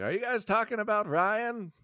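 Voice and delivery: lightly voiced confused old man; a hint of a puzzled grandpa. Old man asks if they mean Ryan, lightly voiced. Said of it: "the old man's like".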